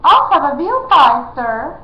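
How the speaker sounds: high, loud whiny demand; a spoiled royal brat complaining; complaining prince. A narrator's reading. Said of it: "complained the prince".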